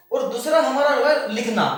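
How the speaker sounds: usual speaking voice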